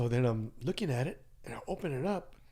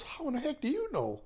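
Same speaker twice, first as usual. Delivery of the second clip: high-pitched, incredulous question; imitated disbelief, voice jumping up high; retold astonishment. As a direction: act out being caught off guard. Retold astonished reply to coworker.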